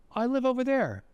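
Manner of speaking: high-pitched, lightly voiced child; little girl's answer. Little girl fibs to the Nazi soldier, high child's voice. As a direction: answer high, held up until the final drop.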